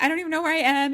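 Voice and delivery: high, loud imitation of panic; freaking out. Leah tells the operator her mom died, freaking out. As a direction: act out a panicked kid on the phone. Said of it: "she's, like, freaking out".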